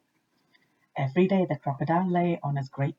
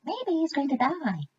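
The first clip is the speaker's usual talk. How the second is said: high, slow chirp; chirping bird; 9 semitones higher than usual. A narrator's reading. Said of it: "chirped a bird".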